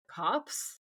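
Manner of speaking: quiet, baffled questioning echo; confused. Retold or imitated. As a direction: imitate puzzled disbelief, softly. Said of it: "adorable confusion on his face".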